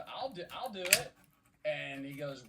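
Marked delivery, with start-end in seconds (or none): 0.1-1.1 eager secretary. Retold or imitated. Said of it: "and the secretary goes".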